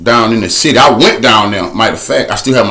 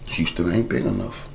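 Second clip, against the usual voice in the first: much softer, lower ominous refrain; hushed refrain; 7 semitones lower, 14 dB quieter than usual. Acted. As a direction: sink the line to a low hush. Repeated refrain, dropped voice.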